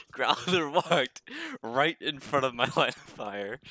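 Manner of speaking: slow, laughing disbelief; real laughter. Real, not acted. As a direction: tell it while cracking up, drawing it out. Cracking up over teammate's blunder.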